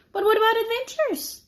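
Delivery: put-on high, eager little girl; eager child. Annie asking teacher about adventures, high and eager. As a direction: pipe up with a hopeful question.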